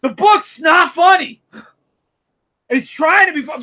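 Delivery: angry fan yelling about the book; angry shout. Real, not acted. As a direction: hammer it home with frustration. Heated rant about the book.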